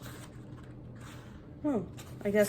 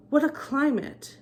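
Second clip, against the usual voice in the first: grumbling; 5 semitones higher than usual. A narrator's reading. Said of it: "he grumbled".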